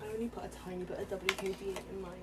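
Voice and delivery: normal talking voice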